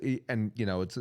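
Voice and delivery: normal talking voice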